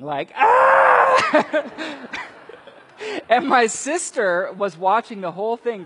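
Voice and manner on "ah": loud high mock scream breaking into a laugh. Preacher reenacting his lightning scream, laughing shriek. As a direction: shriek like a scared kid, laughing. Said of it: "looking up and screaming".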